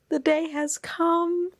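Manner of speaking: high, slow herald; grand announcement. Real, not acted. To viewers, a high, slow herald of arrival. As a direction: proclaim it like a big event.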